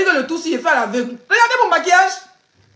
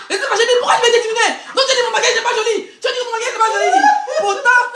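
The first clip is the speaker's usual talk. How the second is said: fast, higher, laughing protest; 7 semitones higher than usual. Real, not acted. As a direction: laugh out the complaint quickly. Fast laughing retold complaint.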